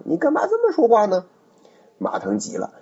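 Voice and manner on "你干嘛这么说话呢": much higher, quick, feigned-innocent question; high probing retort. Acted. Dong Cheng testing Ma Teng by defending Cao, high quick voice. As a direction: toss it up high and fast, playing dumb.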